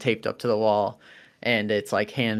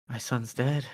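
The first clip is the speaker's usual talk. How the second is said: muttered; 7 dB quieter than usual. Acted. Bereaved father mutters that his son's dead, muttered softly. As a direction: mutter it under the breath. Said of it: "you hear him mutter".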